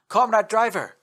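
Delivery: loud, high call across the road. Acted. Russian soldier calling out to a parked truck. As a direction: hail the truck driver. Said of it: "the Russian called out".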